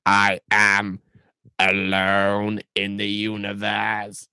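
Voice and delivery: slow, grating Dalek drone. Acted. Dalek answers the Doctor in a slow, grating drone. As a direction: drag it out like a lonely Dalek.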